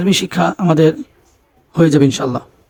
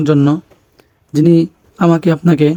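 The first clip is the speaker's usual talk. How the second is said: ordinary talk, nothing marked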